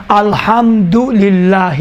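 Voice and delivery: الحمدللہ read slowly and deliberately, each syllable clear; slow demo reading. Shown as a demonstration. Teacher reading الحمدللہ slowly to show students phrase-by-phrase recitation. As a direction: spell out الحمدللہ slowly for dictation. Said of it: "جملہ بے جملہ آرام سے تلفظ کرنا".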